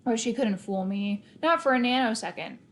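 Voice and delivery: ordinary delivery, nothing marked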